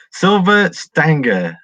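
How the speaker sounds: drawn-out greeting. Real, not acted. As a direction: stretch the name out like a big hello. Greeting a viewer warmly on stream.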